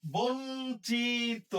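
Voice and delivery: বঞ্চিত stretched long as "bonchit"; drawn-out pronunciation. Shown as a demonstration. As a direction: say বঞ্চিত very slowly with an o. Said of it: "ও এর মতো হবে".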